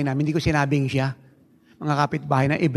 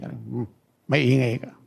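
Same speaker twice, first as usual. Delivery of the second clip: lower, mocking imitation of the police captain; 4 semitones lower, slower than usual. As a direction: play the captain scolding the pastor, lower. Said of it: "Grabe yung kapitan ng pulis".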